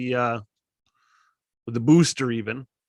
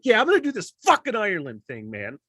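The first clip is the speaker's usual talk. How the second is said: higher, fired-up declaration; pumped-up yell; 7 semitones higher than usual. Retold or imitated. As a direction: shout it like you just decided. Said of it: "Why am I yelling?".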